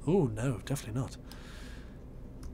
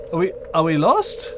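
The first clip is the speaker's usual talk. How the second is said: normal talking voice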